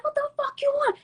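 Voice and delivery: high-pitched, exasperated mock retort; annoyed retort. Storyteller replaying her reply to friend calling her, annoyed retort.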